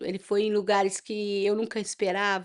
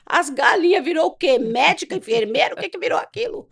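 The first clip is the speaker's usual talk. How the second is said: high, louder, rapid-fire questions; agitated retold questioning; 8 semitones higher, 5 dB louder than usual. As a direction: retell it as worked-up, incredulous questioning. Retold incredulous questioning, raised voice.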